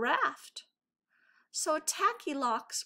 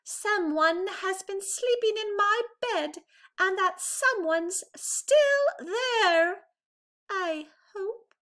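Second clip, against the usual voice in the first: much higher, louder little bear squeal; Baby Bear squealing; 11 semitones higher, 5 dB louder than usual. A narrator's reading. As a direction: squeal it out, hopeful and nervous. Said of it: "And Baby Bear squealed".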